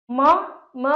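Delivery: usual speaking voice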